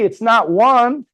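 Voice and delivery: slow emphatic punchline. Real, not acted. Punchline of compounding numbers.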